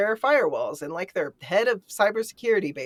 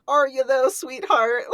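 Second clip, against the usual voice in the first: teasing, higher and louder, as a sarcastic dare; mock sarcasm; 6 semitones higher, 3 dB louder than usual. Host mocking the character's bravado, sarcastic and teasing. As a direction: needle her with fake sweetness.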